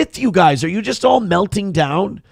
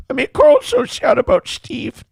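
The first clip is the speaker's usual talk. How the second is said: much higher, whiny mocking voice; mock sad whine. Streamer taunts Carl, high mock whine. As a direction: needle the rival in a fake sob voice.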